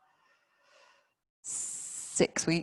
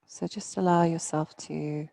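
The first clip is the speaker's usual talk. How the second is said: guided meditation. Real, not acted. Yoga teacher settling students into their bodies, guided meditation. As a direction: ease them down gently, phrase by phrase.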